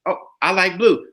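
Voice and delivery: plain-voiced prompt; leading example. Man feeding a woman her opinion, matter-of-fact. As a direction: offer it as the line to copy. Modelling the line to be echoed.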